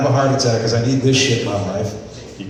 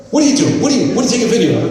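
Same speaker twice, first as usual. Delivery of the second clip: angry yell; 8 semitones higher, much faster than usual. Retold or imitated. Kevin catches him filming.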